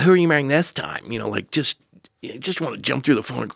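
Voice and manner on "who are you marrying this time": retold mother's jab, higher and louder; exasperated mother. Mother answers son's news that he is getting married, exasperated. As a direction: throw it out as an incredulous dig.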